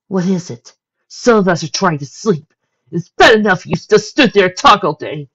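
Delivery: high, loud grumpy whine; complaining. A narrator's reading. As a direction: grumble at being woken. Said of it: "she complained".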